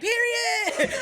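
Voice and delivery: high, loud imitated crowd yell; shouted agreement. Imitating people agreeing about a bad daddy, high hyped yell.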